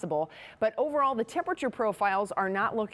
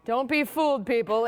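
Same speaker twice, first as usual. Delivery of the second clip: louder, higher playful warning; emphatic warning; 4 semitones higher, 5 dB louder than usual. Real, not acted. Weather forecaster warning viewers, playfully louder. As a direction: call out to viewers not to be fooled.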